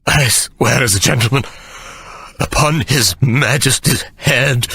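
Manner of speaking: high, slow, breathless vow; gasping oath. A narrator's reading. Sir Jasper swearing to Hannah, breathless high vow. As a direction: gasp out the vow. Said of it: "Sir Jasper gasped".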